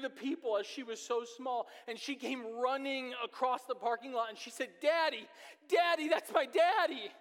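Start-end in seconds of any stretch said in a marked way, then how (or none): 4.8-7.1 put-on high, loud excited child's shout. Father voicing his daughter running across the parking lot, high joyful shout. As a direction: yell it like a toddler running to her dad. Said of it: "she said it over and over".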